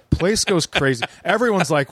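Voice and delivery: usual speaking voice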